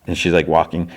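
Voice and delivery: ordinary talk, nothing marked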